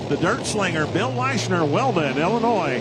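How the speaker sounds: loud, rising hype call; excited announcer call. Real, not acted. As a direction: call it out over the engines. Live pull, calling out driver.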